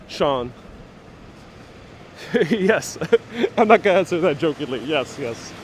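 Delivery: ordinary talk, nothing marked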